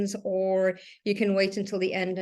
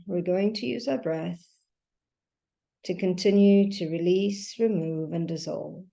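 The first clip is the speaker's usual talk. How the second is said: guided meditation. Acted. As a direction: guide them gently through each breath, leaving long silences. Leads a breathing meditation.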